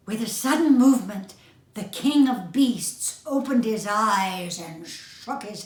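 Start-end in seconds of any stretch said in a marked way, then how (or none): none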